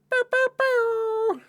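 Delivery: high, slow horn imitation; horn noise. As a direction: toot it like a horn. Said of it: "my little horn thing".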